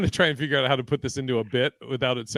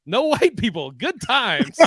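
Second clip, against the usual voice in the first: mock sitcom cheer; 8 semitones higher, 3 dB louder, slower than usual.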